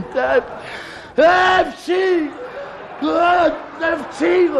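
high building comic sneeze. Acted. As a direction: wind up into a big fake sneeze.

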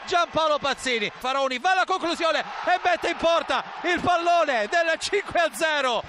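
usual speaking voice

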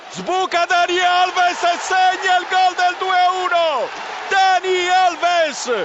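drawn-out excited goal call; goal shout. Real, not acted. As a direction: yell the scorer's name, stretched out. Goal call, Juventus second goal. (urlo del gol, allungato)

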